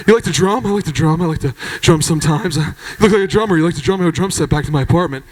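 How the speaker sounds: low mumbled drum talk; low mumbling. Retold or imitated. Jeff asking the comedian about drumming, low mumble. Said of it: "He started mumbling".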